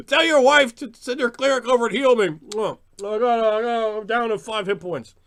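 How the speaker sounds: loud, high whining demand; hammed-up out-of-character pleading; mock player hollering. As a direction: yell for healing across the table. Mocked player begs for healing.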